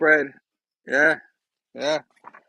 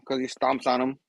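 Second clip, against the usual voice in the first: ordinary delivery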